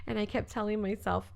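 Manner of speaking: normal talking voice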